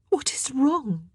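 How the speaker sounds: high, drawn-out anxious demand; urgent, high-pitched question. A narrator's reading. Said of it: "Anne demanded".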